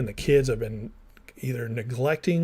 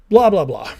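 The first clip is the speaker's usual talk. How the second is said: mock dismissive; 7 semitones higher, 10 dB louder than usual. Brushing off details.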